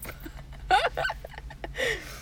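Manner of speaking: high breathless giggle; unvoiced giggle; giggling. Real, not acted. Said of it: "Stop giggling".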